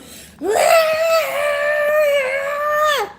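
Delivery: high, loud wailing sob, hammed-up; loud wailing cry. Shown as a demonstration. Showing her viewers how she really cries, loud hammed-up wail. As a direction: let out a huge theatrical wail. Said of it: "That's, that's me crying".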